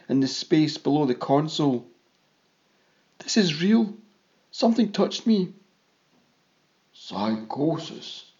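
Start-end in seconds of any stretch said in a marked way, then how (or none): 3.2-5.5 high-pitched, tense insistence; tight, near scream. A narrator's reading. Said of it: "my voice tight now, ready to turn into a scream". 6.9-8.3 slow, softer, assured pronouncement; confident declaration. A narrator's reading. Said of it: "declared Iftek's voice confidently".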